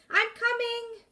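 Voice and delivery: high, loud call. Acted. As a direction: call out across the water again. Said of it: "Minley called again".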